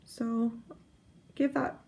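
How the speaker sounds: mid-burp, words caught in the throat. Real, not acted. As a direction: voice interrupted by a burp. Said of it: "excuse me, sorry, I'm burping".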